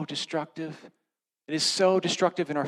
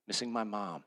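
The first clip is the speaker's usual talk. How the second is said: ordinary delivery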